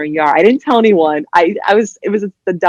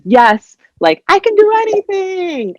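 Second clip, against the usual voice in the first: mock triumph; 13 semitones higher, 3 dB louder than usual. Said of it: "I was like".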